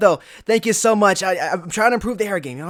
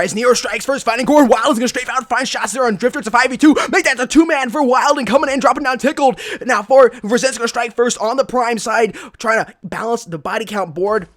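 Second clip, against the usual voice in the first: loud, high esports caster call; live play-by-play; 6 semitones higher, 7 dB louder than usual. Real, not acted. Caster calling a firefight for viewers, excited play-by-play. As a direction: call the action with rising urgency.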